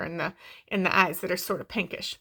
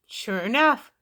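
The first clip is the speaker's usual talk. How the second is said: ordinary delivery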